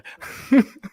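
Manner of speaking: high burst of laughter. Real, not acted. Laughing at own story.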